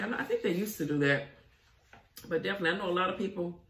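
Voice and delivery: normal talking voice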